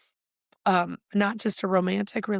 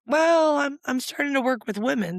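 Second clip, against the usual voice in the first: much higher and louder, eager to share; bright excited answer; 6 semitones higher, 3 dB louder than usual. Retold or imitated. Retelling her eager reply to the publisher, excited. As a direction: perk right up as if the news just landed.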